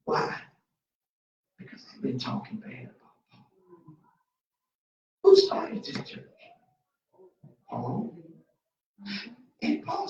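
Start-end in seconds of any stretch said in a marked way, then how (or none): 1.6-4.0 whisper. Acted. Confiding aside as the church.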